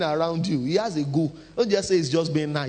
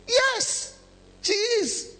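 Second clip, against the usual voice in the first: high emphatic yes; much slower than usual. Real, not acted. Preacher agrees wife is emotional.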